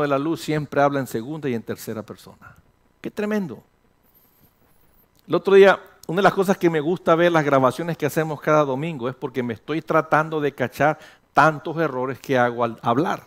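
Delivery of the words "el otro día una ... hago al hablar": ordinary talk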